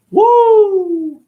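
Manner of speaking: long high, loud "woo"; whoop. Real, not acted. Reacting to own Anne Frank mention, a loud high whoop. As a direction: let out one loud, drawn-out high whoop.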